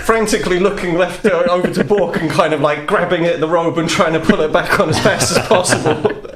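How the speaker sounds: ordinary talk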